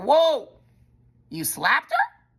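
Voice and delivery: loud, high, shocked question; a loud gasp of disbelief at the slap. Retold or imitated. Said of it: "They were like".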